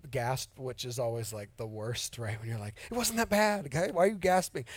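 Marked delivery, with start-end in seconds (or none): 2.9-4.6 higher-pitched mock protest; acted-out indignant comeback to a gasp; defensive retort. Driver protesting to gasping passengers in the car, mock defensive.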